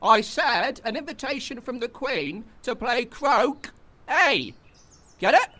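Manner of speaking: slow, high, exasperated emphasis; impatient repeat. Acted. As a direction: spell it out to someone slow, then snap.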